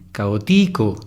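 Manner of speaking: "Caótico" as caoTIco, weight on "ti"; stress on the second syllable. Shown as a demonstration. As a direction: lean on "ti" in "Caótico". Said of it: "Tampoco, ¿verdad?". (acento en la penúltima sílaba)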